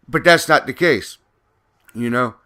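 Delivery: ordinary delivery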